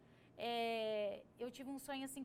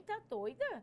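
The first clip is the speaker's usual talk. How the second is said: astonished; 8 semitones higher, 5 dB louder than usual. Retold or imitated. Her astonished reply in dream.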